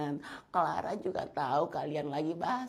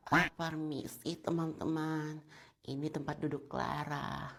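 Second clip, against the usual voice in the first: timid, soft little voice; pick-me girl's breathy-free soft murmur; soft spoken; 2 semitones lower, 6 dB quieter than usual. Acted. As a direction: barely speak up, meek and soft. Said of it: "Soft spoken banget".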